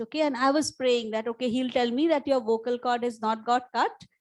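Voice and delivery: ordinary talk, nothing marked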